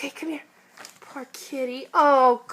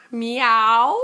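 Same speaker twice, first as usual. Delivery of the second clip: laughing cat meow, a bit louder; playful meow; 7 semitones lower, 3 dB louder than usual. Pretend. A parent meowing for the toddler, laughing.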